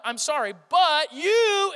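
hammed-up blaming but you; mock accusing apology. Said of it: "they're waiting for the "but".

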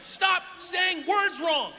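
high, very slow frustrated shout; exasperated yell. Retold or imitated. Kid driven crazy by classmates' accent, yelling. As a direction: scream it like the sound drills your brain. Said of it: "like a drill in my brain".

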